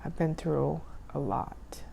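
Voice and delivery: slow hushed whisper. Real, not acted. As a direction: let it out slowly, barely voiced. Solemn confession to viewers.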